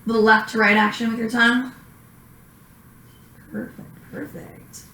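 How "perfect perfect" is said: whispering. Real, not acted. Praising patient during exam.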